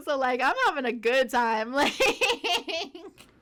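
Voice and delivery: amused, laughing through the words. Real, not acted. Worker enjoying someone else's meltdown, laughing. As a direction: let the giggles take over by the end.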